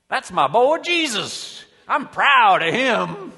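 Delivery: put-on booming, drawn-out Southern accent; proud Southern father hollering from on high; big hammed-up Georgia accent, loud and high; Southern God. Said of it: "not a Californian pretending he's from the South".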